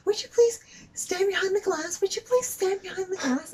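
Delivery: very high, loud imitation of a nagging cashier; mock incessant nagging. Cashier pestering a mother to step behind glass, mock nagging. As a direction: mimic her pestering in a shrieky high pitch. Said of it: "she is so incessant".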